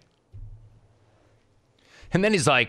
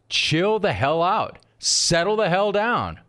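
slow emphatic scold; 5 semitones higher, slower than usual. Real, not acted. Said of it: "telling producer to settle down".

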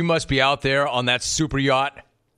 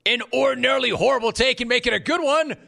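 much higher cartoonish smack voice; strange high voice; 12 semitones higher than usual. Caller delivers his smack in an odd high voice. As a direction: pitch way up, like an overexcited kid. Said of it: "Dude, why are you talking like that?".

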